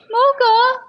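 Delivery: high call. Real, not acted. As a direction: call the dog over. Calling the dog.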